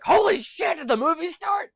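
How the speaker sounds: startled audience. Retold or imitated. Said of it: "people were like".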